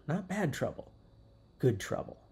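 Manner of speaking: normal talking voice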